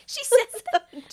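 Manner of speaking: slow, high, loud disbelieving question; stunned question. Real, not acted. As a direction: ask it in shocked disbelief. Reacting to the quoted line.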